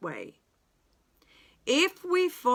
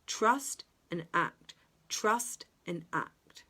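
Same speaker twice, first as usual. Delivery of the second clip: slow mantra; slower than usual. Shown as a demonstration. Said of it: "repeated mantra, slowed".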